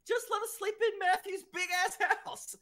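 high-pitched mock pleading; high, held-up exasperated quote; retold plea. Retells own exasperated plea.

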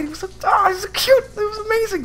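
very high cooing voice; cooing over something cute. Real, not acted. Gushing over cute creature.

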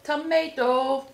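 chewing while talking; talking between bites; mouth full. Real, not acted. Seen 3: Bites burger before, then puts filling piece into mouth while speaking.

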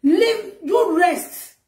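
retold tearful plea. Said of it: "Come and say".

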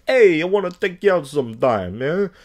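hammed-up Italian guy calling out to a date; mock Italian guy. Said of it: "like a Italian guy shit".

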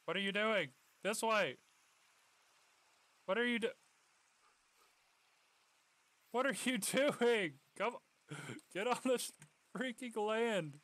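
frustrated owner shouting at swimming dog; exasperated yelling. Real, not acted. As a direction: yell at the dog in disbelief. Dog swims off, owner yells.

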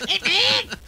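high laughing stoner greeting; Hey Man character. Retold or imitated. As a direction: giggle it like a metalhead. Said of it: "character was called Hey Man".

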